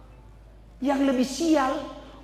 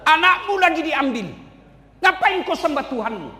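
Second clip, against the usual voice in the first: taunting Satan; 4 semitones higher, 3 dB louder than usual. Acted.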